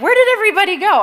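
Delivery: high, loud mock bewilderment; mock bewildered. Mother imitating her sons, loud and high, mock bewildered. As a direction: play the brothers asking where everyone went. Boys vanish from moody sister.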